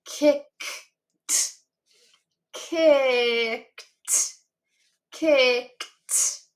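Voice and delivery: kicked repeated with a crisp final t; teacher modelling the t ending; sounding out ending. Shown as a demonstration. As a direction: repeat kicked, landing hard on the t. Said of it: "hear that t sound at the end".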